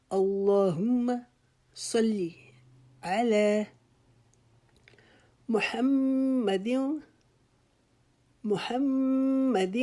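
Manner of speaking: slow chant of اللہم صلی علی محمد, high on Muhammad; devout, melodic recitation; tajweed recitation. Shown as a demonstration. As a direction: draw Muhammad out high and slow with a doubled م and a falling end. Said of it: "محمد کی جو ہا ہے اس کو حلق کے درمیان سے ادا".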